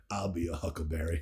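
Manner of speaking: low, very soft drunken impression; Val Kilmer. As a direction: imitate a drunk gunslinger, quiet and low. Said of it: "he's pissed 'cause he's just".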